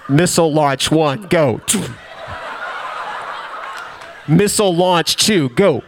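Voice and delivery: low, deadpan launch command; mock military launch call; missile countdown voice. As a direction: announce it like a submarine officer firing torpedoes. Legs fly like torpedoes.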